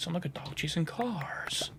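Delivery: lightly voiced Joker line, hushed; soft, whispery movie-villain quote. Gamer quoting The Dark Knight to himself, hushed Joker impression. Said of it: "That's a Joker quote".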